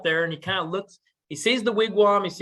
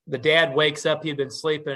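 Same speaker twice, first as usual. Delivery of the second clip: usual speaking voice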